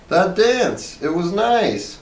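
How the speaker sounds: high, simple wife voice; Hazel's voice. A narrator's reading. Wife insisting to her husband, high and childlike. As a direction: chirp it up high and slow. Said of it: "said Hazel".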